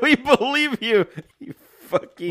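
laughing through the words. Real, not acted. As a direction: speak while laughing, high and loud.

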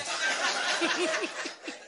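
high wheezy fit of laughter; real laugh. Real, not acted. Preacher cracking up over rich dieters' mornings, laughing. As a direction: crack up at your own joke.

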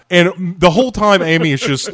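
ordinary talk, nothing marked

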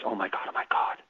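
a sudden realization, barely voiced; stunned whisper. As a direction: breathe "Oh, my God" in a soft, shocked whisper. Matt Damon's character realizing.

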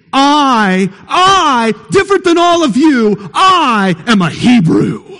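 preacher acting out Jonah's proud shout at the sailors; prideful patriotic cry. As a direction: shout it with chest-out national pride. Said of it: "a prideful cry of patriotism".

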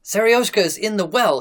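high, loud urgent cry. A narrator's reading. Gardener alerts the searchers without stopping, crying out. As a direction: shout the alarm while on the run. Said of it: "he cried to us without stopping".

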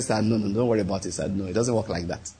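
quiet polite reassurance; humble murmur down the phone; deferential hush. Retold or imitated. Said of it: "I said".

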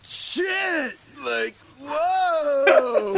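high, loud startled whoa; startled shout. Acted. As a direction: yelp in surprised shock. Reacting to the film scene.

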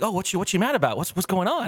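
high, fast puzzled relatives; mock confused relatives. Relatives puzzled by the fuss, high fast mock confusion. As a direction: rush the puzzled questions.

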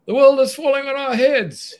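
high, loud panicked cry; panicked shout. Acted. Dopamine shouts while running to the panic button, panicked. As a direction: yell it as the sky falls in. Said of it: "shouted dopamine".

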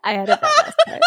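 giggling through the words; laughing. Real, not acted. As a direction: laugh it out, embarrassed. Laughing at own rhyme.

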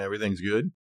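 normal talking voice

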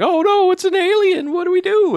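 high, loud panicked cry; scared. Retold or imitated. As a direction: squeal it like a frightened kid. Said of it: "they're just like".

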